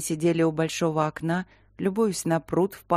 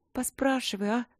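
pleading; 6 semitones higher than usual. A narrator's reading. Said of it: "умоляюще сложила руки".

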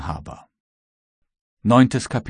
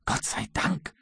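hushed exclamation; 4 semitones higher, 7 dB quieter than usual. A narrator's reading. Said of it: "rief der Kapitän".

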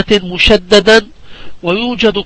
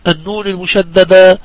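normal talking voice

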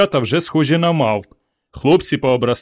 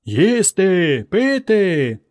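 faint distant echo; 7 semitones higher, much slower than usual. Acted. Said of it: "ледве чутно відлунює".

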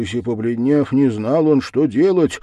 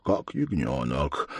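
plain reading voice

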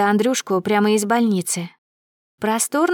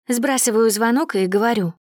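usual speaking voice

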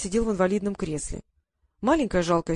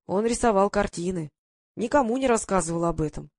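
ordinary delivery

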